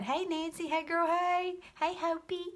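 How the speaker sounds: high cheery hello; high greeting. Real, not acted. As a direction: sing out a hello to a viewer. Greeting viewers in the chat.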